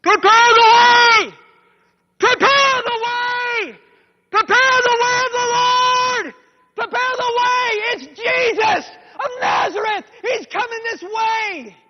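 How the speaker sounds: very high, slow, full-throated shout; screaming and yelling. Acted. Man running toward Jericho heralds Jesus, screaming. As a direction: cry it out from far down the road. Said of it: "he's screaming and yelling".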